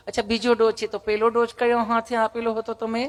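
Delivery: lightly voiced nurse, softened; nurse asking questions. Nurse questioning patient at vaccine centre, lightly imitated. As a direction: play a polite clinic nurse. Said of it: "nurse બેને પૂછવા".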